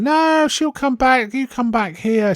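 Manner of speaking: high, slow coaxing landlady voice; weird landlady coaxing. Retold or imitated. Landlady luring the husband back, high slow coaxing. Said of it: "sort of saying".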